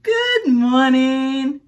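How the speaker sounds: slow, high friendly greeting; a friendly bug's drawn-out good morning; friendly ladybug. Acted. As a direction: greet like a sweet little bug. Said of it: "said the friendly ladybug".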